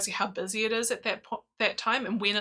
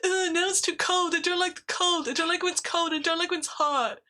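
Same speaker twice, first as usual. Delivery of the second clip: higher whiny moaning voice; whiny complaining; 8 semitones higher than usual. Retold or imitated. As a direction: moan like a grumpy husband. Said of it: "That impression is excellent".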